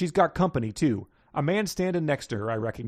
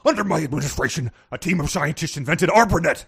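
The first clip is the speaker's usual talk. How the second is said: louder jowly Nixon growl; Richard Nixon impression; 2 semitones higher, 5 dB louder than usual. Shown as a demonstration. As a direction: loosen the jowls and move the head. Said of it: "Just loosen those jowls".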